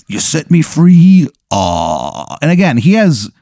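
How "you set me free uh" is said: gargoyle voice. Acted. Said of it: "he's like".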